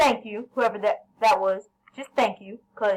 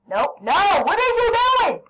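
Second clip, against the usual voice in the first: sudden high yell at a teammate; 11 semitones higher, 6 dB louder than usual. Real, not acted. Gamer yelling at a teammate who messes up, loud and high. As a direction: shout in real frustration. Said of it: "I'm sorry, guys, that I just yelled".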